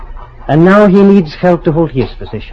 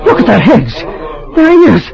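horrified outcry; 10 semitones higher than usual. Acted. Professor seeing the spies' cut ears, horrified outcry. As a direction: recoil at the sight, voice climbing higher.

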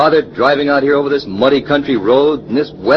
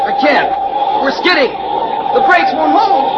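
panicked shout. Acted. Driver panicking as the car skids to the cliff. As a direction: scream as the car slides away.

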